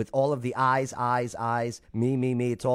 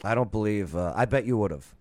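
ordinary delivery

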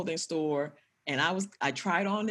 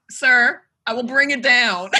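loud, laughing declaration to him; mock formal vow; 4 semitones higher, 8 dB louder, slower than usual. Talking to her ex through the mic, loud mock vow. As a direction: address him like a stern official. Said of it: "I wanna say his name".

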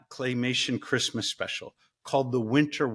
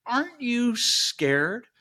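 slow, higher mock frost spirit asking; lightly voiced winter sorcerer, slow and curious; Morozko; 7 semitones higher, much slower than usual. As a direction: draw the question out slowly like a probing spirit. Morozko questions girl in story.

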